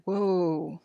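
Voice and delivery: plain reading voice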